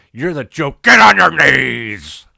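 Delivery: shouted, high and slow at the end; furious shout. Retold or imitated. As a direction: bellow the order down at him. Vince screams at Mick.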